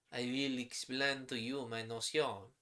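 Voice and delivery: put-on measured, careful French-accented English. Professor Masson explaining to bystanders, in a French accent. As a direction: speak it like a French chemist, in a French accent. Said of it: "my terrible French accent".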